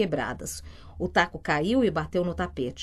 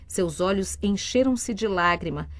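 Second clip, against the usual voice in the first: usual speaking voice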